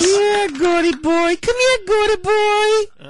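very high, slow breathy woman's voice; high sultry female impression; Linda Lovelace. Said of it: "Linda Lovelace".

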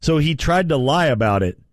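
ordinary delivery

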